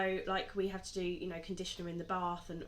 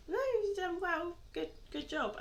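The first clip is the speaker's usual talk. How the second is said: reassuring husband; 11 semitones higher than usual. Retold or imitated. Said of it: "Cyril's like".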